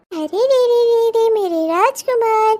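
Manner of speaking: put-on slow, lilting coo to a baby; sing-song comforting; soothing baby talk. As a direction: hush a crying baby with drawn-out syllables.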